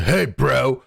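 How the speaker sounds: half-hearted Australian bro impression; playfully parrots the surfer in an Aussie accent; imitating Australian bro. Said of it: "You said".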